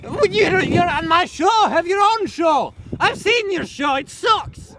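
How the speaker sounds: high-pitched, drawn-out indignation; heated bickering. Acted. Nature host telling rival to get his own show, indignant. Acted quarrel over the show.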